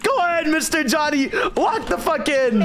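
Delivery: high, hyped YouTube host voice; big energetic challenge announcement; Mister Beast intro. Acted. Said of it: "who wants to do the Mister Beast intro".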